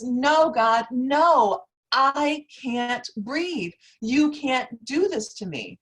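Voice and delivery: loud, high, anguished plea, slowing on I can't breathe; desperate pleading. Retold or imitated. Woman who cannot breathe begging the officer, loud and high. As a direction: cry out begging not to be taken.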